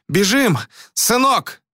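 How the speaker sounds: high, urgent cry to run; panting shout to flee; breathless shout. A narrator's reading. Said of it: "задыхаясь, крикнул Карадур".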